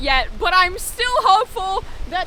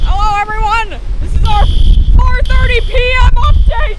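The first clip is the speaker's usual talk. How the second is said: loud upbeat mock news report; news reporter; 2 semitones higher, 9 dB louder than usual. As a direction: play the on-the-scene storm reporter.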